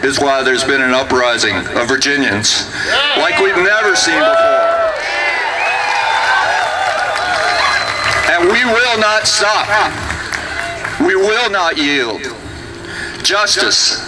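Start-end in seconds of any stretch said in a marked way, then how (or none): 3.2-14.0 rising to a roaring shout; rally the crowd, full voice; roaring rallying speech. Real, not acted. Rally speech climax, crowd chant.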